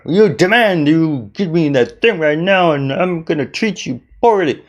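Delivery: mock pushy demand; lightly hammed-up rude customer; demanding rude person.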